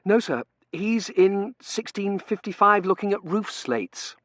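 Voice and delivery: high, slow explanation; nervous junior. Acted. T J explaining where the assistant head is, nervous high voice. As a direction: pitch it high, reporting to the boss.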